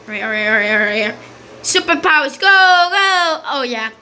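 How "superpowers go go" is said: high, stretched-out power cry; superhero call. Pretend. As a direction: launch it upward on a long "go". Calls out activating game power.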